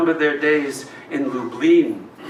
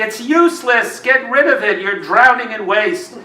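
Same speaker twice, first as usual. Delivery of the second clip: higher, louder scolding cry; mock scolding shout from the neighbours; quoted scolding; 7 semitones higher, 3 dB louder than usual. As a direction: throw their nagging back at them, raised. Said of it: "To those who scream at me".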